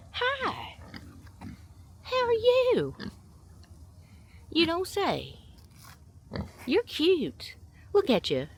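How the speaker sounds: very high, loud cooing voice for the animal. Real, not acted. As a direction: squeal sweetly at the cute animal. High baby talk to animal.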